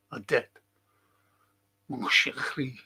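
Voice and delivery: put-on soft French accent; French-accented sweet nothing. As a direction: try out a French accent, softly. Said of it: "Is that a good accent?".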